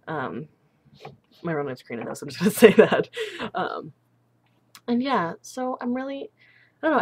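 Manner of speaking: ordinary delivery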